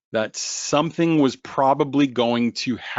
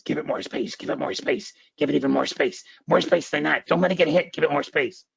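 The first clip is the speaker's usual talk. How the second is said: urgent, higher-pitched crazy head voice, starting whispery; crazy inner voice; 4 semitones higher, faster than usual. Acted. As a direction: pester like a mad voice inside someone's head. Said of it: "that other crazy voice in your head goes".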